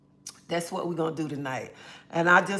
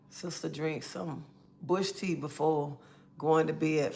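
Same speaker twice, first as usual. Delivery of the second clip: ordinary talk, nothing marked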